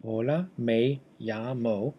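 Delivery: slow Spanish in an English accent; deliberate English-accented Spanish. Shown as a demonstration. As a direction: read the Spanish slowly with English sounds. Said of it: "kind of an English manner".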